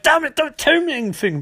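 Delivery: high-pitched exasperated outburst; mock panic, cutting someone off. Harrison stops Barry telling him about the future, exasperated.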